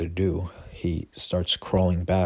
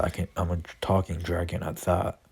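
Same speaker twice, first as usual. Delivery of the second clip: ordinary delivery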